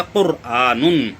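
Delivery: कुरआनुन slow with a heavy r, recited; Quranic recitation. Shown as a demonstration. Teacher reciting the example word, slow and heavy. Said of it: "यहाँ पर भी रा पुर है".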